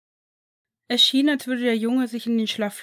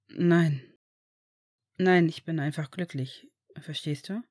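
lower, slow reassuring fatherly voice; gentle father; 3 semitones lower than usual. Acted. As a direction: promise him he is safe now. (tiefer, ruhiger, beruhigender Vater)

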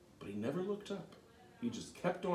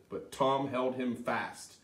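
ordinary delivery, nothing marked

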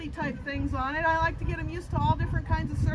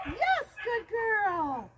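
slow, stretched-out happy praise; 4 semitones higher, much slower than usual. Shown as a demonstration. Trainer showing the happy voice to praise the puppy. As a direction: draw it out for her. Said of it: "Happy voice, you guys".

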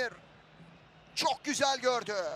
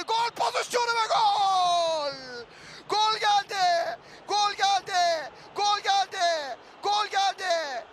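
screaming football commentator; 3 semitones higher, slower than usual. Real, not acted. Goal scored, commentator erupts.

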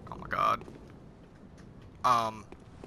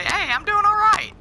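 mock cheerful; 7 dB louder than usual. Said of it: "As soon as I say".

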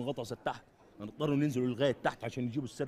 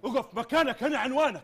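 mock challenge; 10 semitones higher, 8 dB louder than usual. Mock police challenge, laughing.

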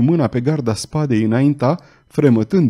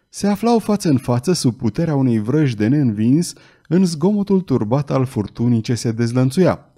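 plain reading voice

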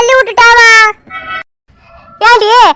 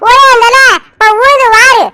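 put-on higher childlike whiny voice; little girl complaining; 4 semitones higher, slower than usual. Small girl tattling to mother, childlike whine. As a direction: play a small girl telling on her playmate.

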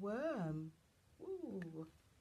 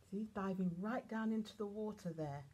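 ordinary delivery

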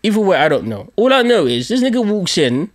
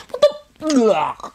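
higher, strangled cry of shock; startled cry; 6 semitones higher than usual. Retold or imitated. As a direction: gasp it as the punch lands. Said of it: "imagine the force of me going".

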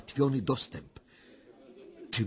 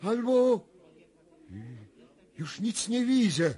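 higher, rising alarm as the vision unfolds; higher, tense visionary urgency; agitated trance vision; 10 semitones higher than usual. Acted. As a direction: speak as if seeing it, growing alarmed.

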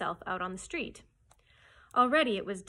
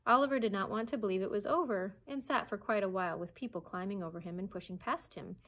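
ordinary delivery, nothing marked

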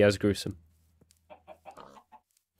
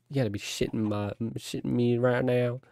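ordinary talk, nothing marked

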